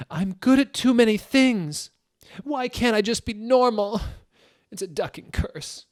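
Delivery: high, loud melodramatic wail; hammed-up shout. Reading the fanfic heroine's outburst, hammed-up high shout. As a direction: overplay the tortured teen girl shouting. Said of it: "I shouted".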